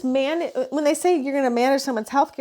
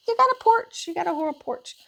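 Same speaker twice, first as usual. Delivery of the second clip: talking to the cat; 9 semitones higher than usual. Real, not acted.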